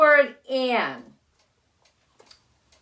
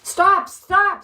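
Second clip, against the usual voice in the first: higher, louder call after the runaway; calling stop; 7 semitones higher, 5 dB louder than usual. Acted. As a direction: Gran calls after the little man to stop. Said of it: "said Gran".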